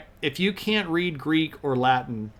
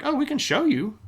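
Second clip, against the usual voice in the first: much higher, breezy offer; chirpy, lightly teasing offer to help; mock helpful Byzantine; 12 semitones higher than usual. Byzantine offering crusaders to show them, breezy high voice.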